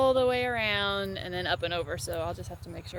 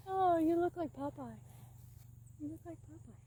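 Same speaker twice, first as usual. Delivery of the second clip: higher fond cooing; pet talk; 7 semitones higher, 9 dB quieter than usual. Real, not acted. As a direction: tease the horse fondly, up high. Cooing to horse.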